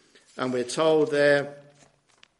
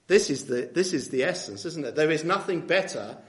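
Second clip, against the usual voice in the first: ordinary delivery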